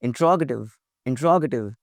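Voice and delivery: "interrogative" as in-TROG-a-tiv, falling; correct pronunciation. Shown as a demonstration. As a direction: stress the "rog" in "interrogative". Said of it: "the correct pronunciation of the word is".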